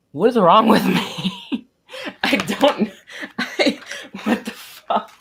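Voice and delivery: loud, high, cracking up at yourself; helpless laughing. Real, not acted. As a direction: lose it laughing in disbelief. Cracking up at own old drawings.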